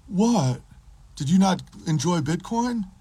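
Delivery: high, incredulous put-on voice; mocked puzzled libertarian. Libertarian hearing bad news from his friend, mocked high voice.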